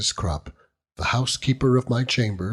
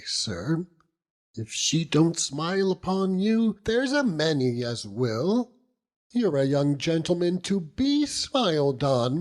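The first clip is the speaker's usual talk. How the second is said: higher, gushing motherly housekeeper voice; fussing housekeeper; 8 semitones higher than usual. Acted. Housekeeper Mrs Crupp cheering lovesick lodger, higher gushing voice. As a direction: coax him like a nosy landlady.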